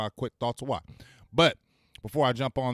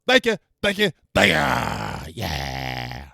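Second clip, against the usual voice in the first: mock bow thanks; 2 semitones lower, 8 dB louder, much slower than usual. Mock thanks after dance.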